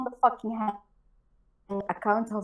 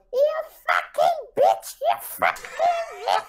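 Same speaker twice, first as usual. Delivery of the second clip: mock witch screech. Mocking the Hangouts enemies, high screechy witch voice. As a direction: cackle it like a witch with a stick up her ass. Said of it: "That's how they were sounding".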